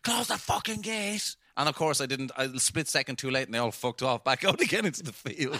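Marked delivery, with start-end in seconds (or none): 0.0-1.4 retold shout. Storyteller repeating the farmer's order for laughs, retold shout. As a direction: echo the farmer's bellow with the volume held back. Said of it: "He's shouting at me".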